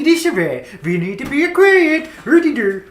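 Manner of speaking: loud, high squealing Swedish Muppet voice. Pretend. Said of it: "take over your Swedish voice".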